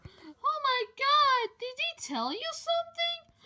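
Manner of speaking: high squeaky toy voice; high character voice. Pretend. Another toy character speaks.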